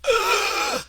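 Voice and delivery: high frightened gasp; sharp gasp. Retold or imitated. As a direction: suck in air in fright. Child's stress reaction.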